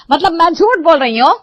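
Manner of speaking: loud, high offended retort; indignant outburst. Acted. Mother-in-law taking offence at her daughter-in-law, loud indignant shout. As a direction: snap back as if insulted.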